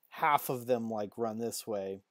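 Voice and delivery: normal talking voice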